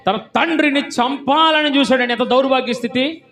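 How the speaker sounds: loud, high-pitched denunciation from the pulpit; full-force preaching. Real, not acted. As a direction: thunder it out to the congregation.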